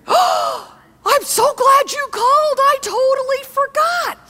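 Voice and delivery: overjoyed friend who forgot, caught on the phone; gushing friend. Pretend. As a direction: swoon over the call like a scatterbrained friend.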